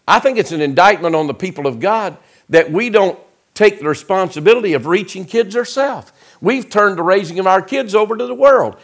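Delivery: high-pitched, fired-up pulpit delivery; forceful preaching. Real, not acted. Preacher vows to win kids back from travel ball, forceful preaching.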